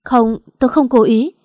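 loud, frightened denial; panicked. A narrator's reading. Hiền panicking after pushing her down the stairs. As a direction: blurt it out in panic. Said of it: "hoảng hốt nói".

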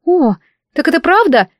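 high, eager gasp of a maid; excited exclamation. A narrator's reading. Maid eager to confirm palace gossip, excited high exclamation. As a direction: exclaim it with rising curiosity. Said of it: "девушка сверкнула глазами и вскрикнула".

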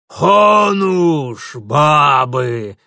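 громкий грубый хор воинов; проревите хором; дружный рёв. A narrator's reading. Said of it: "Слаженным рёвом откликнулись остальные". (слаженный рёв)

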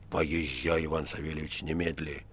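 low, hushed order; quiet, grave boss. A narrator's reading. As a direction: give the order softly and low. (тихий низкий приказ)

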